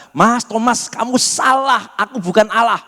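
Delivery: mock correction. Mock correction Jesus never said.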